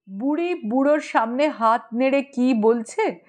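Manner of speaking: usual speaking voice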